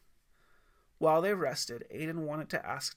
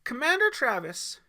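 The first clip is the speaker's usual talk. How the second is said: put-on high, squeaky teen cry; high squeaky shout of a name; squeaky call; 9 semitones higher, 7 dB louder than usual. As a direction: squeak it out, voice cracking up. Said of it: "Bear squeaked".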